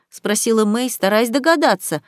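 ordinary delivery, nothing marked

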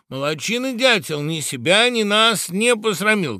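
high, loud acclaim; shouted praise. A narrator's reading. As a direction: cheer him on like a crowd. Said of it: "кричали птицы".

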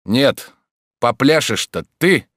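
a hero cutting in with authority; firm manly voice. A narrator's reading. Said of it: "послышался твёрдый мужественный голос". (твёрдый мужественный голос)

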